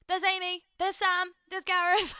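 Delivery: high, loud gleeful naming; excited pointing out. Retold or imitated. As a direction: call out friends on screen gleefully. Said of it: "I'm like".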